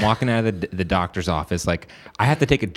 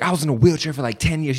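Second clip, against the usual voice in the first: higher-pitched mock-angry protest; imitated anger; 6 semitones higher than usual. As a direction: imitate an irate man listing his hardships. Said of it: "he was angry".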